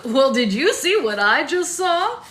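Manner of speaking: loud, high, drawn-out showman's astonishment; big, swooping TV-host disbelief; comical shock. A narrator's reading. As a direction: exclaim it slowly in hammed-up shock. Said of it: "stretched into a comical mask of shock".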